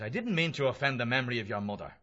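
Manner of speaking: usual speaking voice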